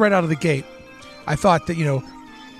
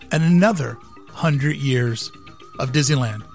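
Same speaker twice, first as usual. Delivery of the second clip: dramatic speech; 5 dB louder than usual. Acted. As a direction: deliver it like a keynote pitch. Dramatic visionary monologue over music.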